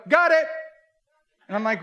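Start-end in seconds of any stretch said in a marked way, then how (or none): 0.1-0.5 higher, louder, casually smug; smug got it. Retold or imitated. Mall shoppers smugly spotting the hidden image. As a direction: brag that you see it at once. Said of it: "and they go".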